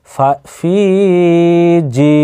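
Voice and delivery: ফি and জি held long and level; drawn-out vowels. Shown as a demonstration. Teacher modelling madd for learners, ফি and জি drawn out. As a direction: stretch the vowels of ফি and জি. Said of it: "ফাকে এবং জিমকে টানবেন".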